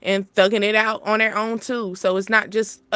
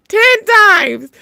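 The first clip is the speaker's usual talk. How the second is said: much higher and louder, laughing burst; emphatic exclamation; 11 semitones higher, 10 dB louder than usual. Real, not acted. Telling partner she'll shower ten times, loud laughing burst. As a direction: blurt it out loud through laughter.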